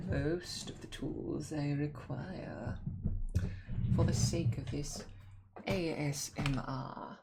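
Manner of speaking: whispery ASMR voice; ASMR whisper. Acted. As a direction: draw them into the ASMR softly.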